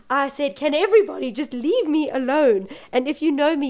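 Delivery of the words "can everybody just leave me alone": exasperated plea, higher and louder; retold losing it. Mother to hovering nurses, retold exasperated outburst. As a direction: act out snapping at everyone, moderately. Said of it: "I lost it even more".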